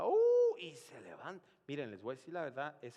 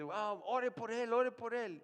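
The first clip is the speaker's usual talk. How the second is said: urgent plea; 8 semitones higher, 6 dB louder than usual. Retold or imitated. Relative urging the pastor to pray.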